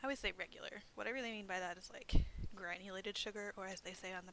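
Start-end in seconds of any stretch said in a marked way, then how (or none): none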